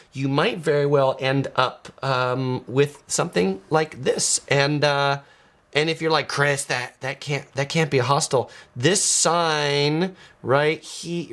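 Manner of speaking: usual speaking voice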